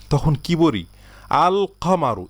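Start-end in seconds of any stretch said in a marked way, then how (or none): 1.5-2.2 higher-pitched "আল কামারু" with a clearly held l; article l pronounced. Shown as a demonstration. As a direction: hold the l before k in "আল কামারু", pitched up. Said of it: "এই লামটা আমরা উচ্চারণ করি". ("আল কামারু", লাম টেনে স্পষ্ট উচ্চারণ)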